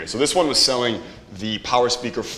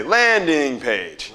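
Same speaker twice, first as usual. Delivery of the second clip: LANDING stretched slowly, pitched high, falling on page; "landing page" in slow, playful overemphasis; drawn-out emphasis; 4 semitones higher, much slower than usual. Shown as a demonstration. Coach leading the class in repeating it, drawn-out emphasis on LANDING. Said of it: "You gotta say it like that".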